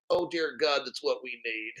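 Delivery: hushed with emotion; overcome. Real, not acted. Streamer to chat, quiet awed murmur. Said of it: "getting emotional".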